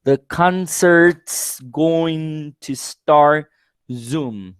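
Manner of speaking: The concert's going to start soon, drawn out slowly; teacher stretches every word; slow full form. Shown as a demonstration. Teacher demonstrates the contraction sentence slowly. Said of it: "in an informal writing, for example".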